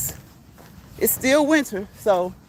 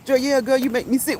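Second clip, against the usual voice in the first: ordinary talk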